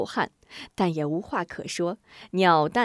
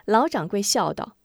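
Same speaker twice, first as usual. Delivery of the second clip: usual speaking voice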